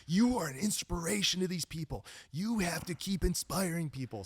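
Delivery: high, emphatic urging of a friend; retold tough-love pep talk; friendly scolding. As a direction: drive it home, insistent but on their side. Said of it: "lays into me, like, in a friendly way".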